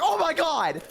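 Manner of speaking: high, alarmed onlooker cry; mock shock. Onlookers reacting to an elf's face plant, high mock alarm. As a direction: gasp it out, voice shooting up.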